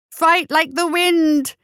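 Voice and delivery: high, loud, long-drawn yell; slow, high rallying holler; shout. A narrator's reading. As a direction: stretch the order out as a big hint. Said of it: "he shouted".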